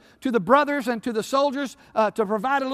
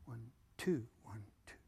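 much softer, low, barely voiced counting; stunned counting; 11 semitones lower, 19 dB quieter than usual. Servant counting the enemy in hushed dismay. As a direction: count under your breath in disbelief.

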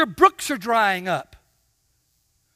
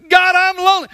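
higher, much louder mock wail; whiny complaint; 7 semitones higher, 9 dB louder, faster than usual. Preacher voicing a lonely believer crying to God, loud mock wail. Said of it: "voicing believer's complaint".

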